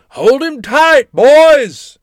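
high, slow, booming holler; big booming shout. A narrator's reading. Great-uncle ordering his men from high above, booming. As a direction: call it out loud across a distance. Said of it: "I heard a big booming voice".

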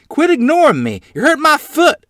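high, loud ranting yell. A narrator's reading. Child rants at the horse ignoring them, yelling. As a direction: rant at top pitch, exasperated. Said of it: "I ranted at my gentle horse".

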